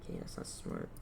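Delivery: usual speaking voice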